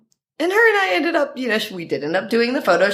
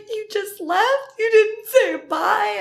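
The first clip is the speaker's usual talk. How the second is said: much higher, slow, wounded mock-whine; imitating her crying; slower than usual. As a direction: plead like a hurt friend on the phone. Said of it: "she called me crying".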